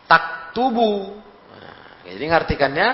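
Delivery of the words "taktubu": "Taktubu" drawn out slowly and high; slow Arabic demo. Shown as a demonstration. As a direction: model "Taktubu" slowly for the class. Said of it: "Perempuan apa?". ("Taktubu" diucapkan pelan dan tinggi)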